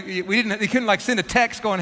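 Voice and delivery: ordinary delivery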